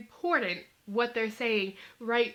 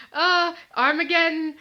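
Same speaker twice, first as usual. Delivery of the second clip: mock dramatic; 4 semitones higher, 7 dB louder than usual. Real, not acted. Describing the snort.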